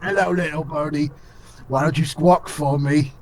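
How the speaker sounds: Cockney taunt, pitched closer to normal; Cockney hobgoblin. Acted. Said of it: "Cockney? Okay".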